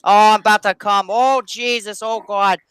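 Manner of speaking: high, loud, hammed-up Boston accent; mock Boston accent. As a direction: moan it out in a high Boston parody, building. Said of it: "like, Boston accent".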